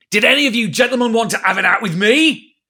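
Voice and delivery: pirate captain roaring a challenge. A narrator's reading. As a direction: dare the whole crew at once. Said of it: "roared Silver".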